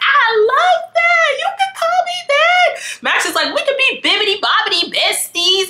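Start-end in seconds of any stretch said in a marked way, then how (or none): 0.0-3.0 very high, slow delighted gush; squealing delight. Retold or imitated. Lauren delighted. 3.5-5.7 high playful voice. Retold or imitated. Max playful quote.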